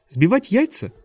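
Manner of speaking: high, incredulous rising question; disbelief. A narrator's reading. Klaus doubts friends are only beating eggs, high incredulous query. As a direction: echo it upward in disbelief. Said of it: "с недоверием спросил Клаус".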